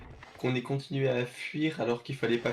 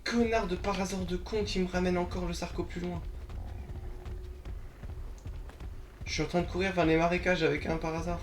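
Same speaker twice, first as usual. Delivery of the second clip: agitated rant; 6 semitones higher than usual. Real, not acted. Ranting while fleeing.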